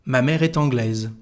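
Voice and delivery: "est anglaise" linked with a sounded T; T liaison. Shown as a demonstration. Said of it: "a liaison between the T and".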